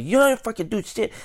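high-pitched mock anger; retold angry defiance. Customer defying the worker's threat to kick him out, high mock anger.

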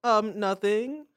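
high, loud, drawn-out denial; mock innocent. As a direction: play innocent in a slow, high voice. Stalin feigns innocence when asked.

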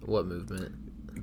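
slow, soft whispered question; mock conspiratorial whisper. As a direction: hush it like a secret.

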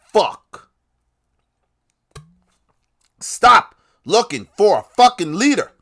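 loud, high, exasperated yell; real frustrated outburst; angry shout. Real, not acted. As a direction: bark it at the crowd. Rant at followers, yelling.

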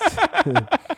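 loud high burst of laughter. Real, not acted. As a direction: squeal of laughter. Laughing shock at friend.

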